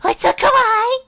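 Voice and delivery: high-pitched mock kawaii gushing; anime girl squeal. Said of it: "some, like, Japanese thing like".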